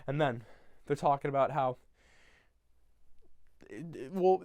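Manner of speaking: ordinary talk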